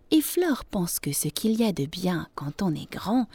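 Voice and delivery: usual speaking voice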